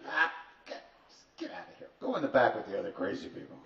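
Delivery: mock-irritated brush-off, rattled off at the end; brusque dismissal. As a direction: act out an impatient guru waving someone away. Guru shooing the newcomer away.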